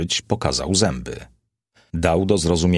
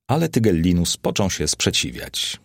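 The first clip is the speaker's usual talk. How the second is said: plain reading voice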